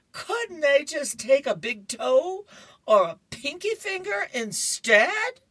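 high, loud mock-crying plea; Curtis crying hysterically. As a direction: plead in hammed-up hysterics. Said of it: "he's still crying and he's hysterical".

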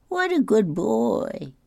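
high, slow doting praise; exaggerated high pat-on-the-head voice; gushing over a child. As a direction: coo it drawn-out in a high voice. Repeats the praise higher.

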